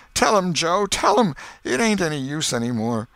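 high, loud, broken surrender; hopeless plea. A narrator's reading. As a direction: give up, begging Joe to tell. Potter giving up.

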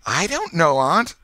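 put-on high, loud, breathless boy's reply; boy gasping through his laughter. A narrator's reading. Boy caught laughing answers his aunt, high and breathless. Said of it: "gasped the boy".

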